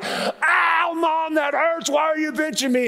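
put-on a pinched child crying out to her mom; child yelping. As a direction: squeal in pain like a little girl. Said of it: "she'd be like".

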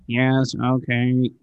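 slow, droning monotone; Ferris Bueller drone. Acted. Interviewer mimicking unexcited tax people, slow monotone drone. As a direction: drag it out like a bored roll call. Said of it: "It's like the Ferris Bueller".

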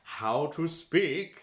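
slow, drawn-out segment announcement; mock announcer. Podcast hosts launching their next segment, hammed-up announcer voice.

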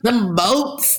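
mock southern them boats; lightly copying a southern drawl; imitating Tennessee accent. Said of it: "that's my Tennessee accent".

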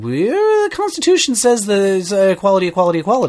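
high-pitched put-on voice of an objector; hammed-up quoted protest; mock protest. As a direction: plead the case in a silly high voice. Mocking retelling of the legal argument.

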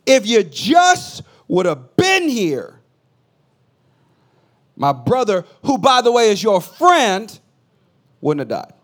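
loud, high, slow accusing shout; angry accusation. Retold or imitated. Martha scolding Jesus for her brother's death, shouting. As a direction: jab the words at him like a pointed finger. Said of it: "I imagine her pointing her finger at Jesus".

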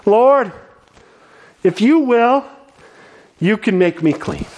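desperate plea. Acted. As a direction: plead for healing with everything. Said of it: "the desperation in his heart and his voice".

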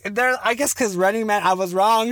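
normal talking voice

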